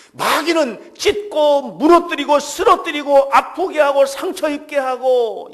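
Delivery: loud, fervent sermon delivery; preaching at full force. Acted. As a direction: preach it out with full conviction to the congregation. Preaches loudly.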